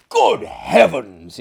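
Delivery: loud, slow startled cry; shocked exclamation. Acted. Host shocked that the guest never heard of them, loud and slow. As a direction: recoil in astonishment. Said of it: "ejaculated my host".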